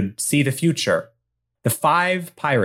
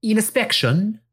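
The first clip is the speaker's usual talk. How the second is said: higher, lightly put-on Spanish accent; Spanglish accent; 8 semitones higher than usual. Spanish woman calling up from the docks, light Spanish accent. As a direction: call out with a hint of Spanish accent. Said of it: "the sound of a small Spanglish woman".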